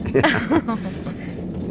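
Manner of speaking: a short, high, amused laugh; light laugh.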